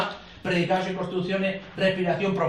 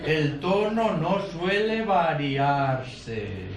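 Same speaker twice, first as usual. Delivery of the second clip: slow, even and unhurried, pitch barely moving; slow, measured speech; 2 semitones lower, much slower than usual. Shown as a demonstration. Teacher demonstrating how the auditory type talks, slow and even. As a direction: speak as if listening to yourself. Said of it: "habla pausadamente".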